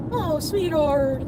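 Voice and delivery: high, drawn-out soothing coo; calm cooing. Retold or imitated. Petting her head to soothe her, calm cooing. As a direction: coo slowly to calm a timid pet. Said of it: "talk calmly, like".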